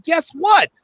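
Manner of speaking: sudden heated retort; dismissive outburst. Real, not acted. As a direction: shut him down hard. Host snaps at a super chat.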